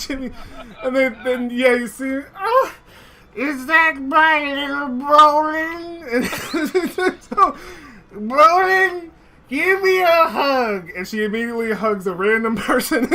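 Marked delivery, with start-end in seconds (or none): 2.4-10.9 high, slow, loud doting granny voice; old dwarf aunt greeting her nephew; old auntie. Acted. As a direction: coo like a half-blind grandma.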